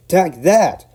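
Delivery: very loud, high shout; Scottish shout. Acted. Donald bumping the van, shouting. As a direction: bump it hard with a shout. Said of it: "Donald burst out".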